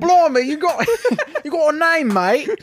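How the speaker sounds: loud, high Cockney caricature, cracking up; mock British accent. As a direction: belt out hammed-up British through laughter.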